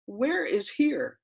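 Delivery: high, very slow puzzled question; repeating to voice assistant. Acted. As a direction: drag it out for a dim assistant. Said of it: "this is the transcript of that conversation".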